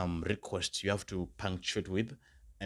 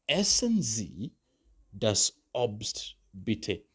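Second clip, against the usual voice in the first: very slow repeat with raised start; 2 semitones higher, 3 dB louder, much slower than usual. Shown as a demonstration. Teacher repeating the request for students, very slow. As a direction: stretch out the repeat for learners. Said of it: "the last request I could give".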